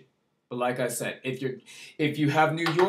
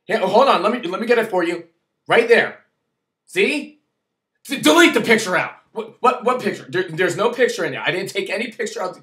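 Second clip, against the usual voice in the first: loud, hurried placating; flustered compliance; 2 semitones higher, 6 dB louder than usual. Retold or imitated. Speaker nervously showing the officer the camera, flustered. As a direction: scramble to hand it over, nervous. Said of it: "So I was just like".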